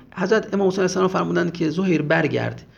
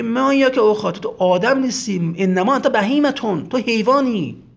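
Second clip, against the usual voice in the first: louder, higher retold insult; scornful insult; 6 semitones higher, 5 dB louder than usual. Shemr being told he is an animal, scornful. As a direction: hurl the quoted insult with force. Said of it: "توهین و تحقیر و استهزاء".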